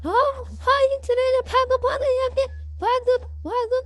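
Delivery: high squeaky cartoon animals shrieking in fright; panicked little critters crying witch, very high; frightened animal voices. A narrator's reading. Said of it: "Gauri को देख के सारे डर जाते हैं". (बहुत ऊँची, डरी हुई जानवरों की आवाज़)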